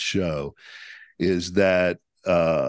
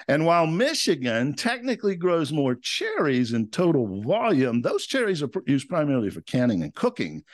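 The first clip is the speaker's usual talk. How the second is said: upbeat scripted announcer read, higher-pitched; podcast host intro; 5 semitones higher than usual. Real, not acted. Host introducing the show and guest to podcast fans, announcer intro. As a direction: welcome listeners with a big, lively radio-style delivery.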